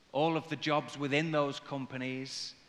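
usual speaking voice

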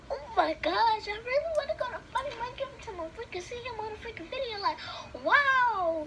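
high-pitched starstruck gush; gushing excitement. Retold or imitated. Child retelling her wish to see Funny Mike, high excited gushing. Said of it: "I've been wishing to go".

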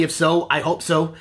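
ordinary delivery

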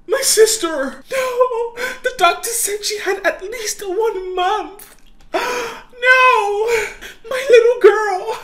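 loud, high anguished cries; family howling over the lost girl; grief-stricken wailing. Acted. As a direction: wail in grief. Grief after death.

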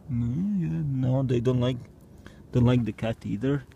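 ordinary delivery

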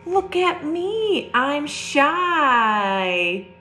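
lifted, sing-song "Look at me"; picture book read-aloud; storybook refrain. A narrator's reading. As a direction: read the refrain brightly for kids. Gasp then refrain.

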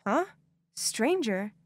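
ordinary delivery

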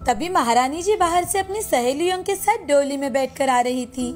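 ordinary delivery

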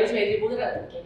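usual speaking voice

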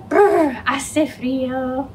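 shivering brr, slow; teeth chattering. Pretend. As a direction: act out being cold, slowly. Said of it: "now in Spanish, ready?".

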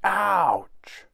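high, loud drawn-out ouch; pained cry. A narrator's reading. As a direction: yelp on a hard landing. Tom lands hard in grass.

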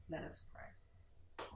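soft, slow invitation to pray; bow into prayer; hushed prayer call. Real, not acted. Preacher turning the broadcast to prayer, hushed.

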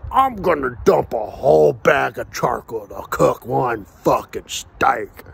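slow, lumbering mock-dad voice; mocking, drawn-out impression of a clueless father; dumbass father. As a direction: drag the words out like a dim-witted dad. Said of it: "my fucking dumbass father".

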